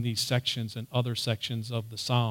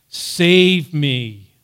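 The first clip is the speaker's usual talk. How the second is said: loud cry for rescue; calling out; 9 dB louder than usual. Retold or imitated. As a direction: call out forcefully in prayer. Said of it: "calling out".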